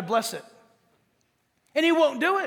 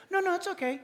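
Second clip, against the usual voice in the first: mock friend; 7 semitones higher, 4 dB quieter, faster than usual. Friends refusing again.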